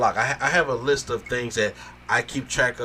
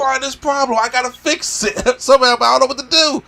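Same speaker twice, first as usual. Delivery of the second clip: inner voice quote; 11 semitones higher, 5 dB louder than usual. Retold or imitated. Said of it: "You're like".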